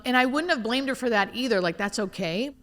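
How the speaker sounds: normal talking voice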